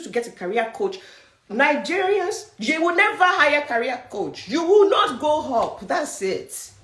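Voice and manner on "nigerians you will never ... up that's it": higher and louder, hammering the point home; emphatic call-out. Real, not acted. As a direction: call them out hard, like a coach. Coach calling out Nigerian audience.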